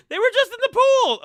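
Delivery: high, loud laughing excuse; mock wife excuse. Wife excusing small cookies, high and laughing.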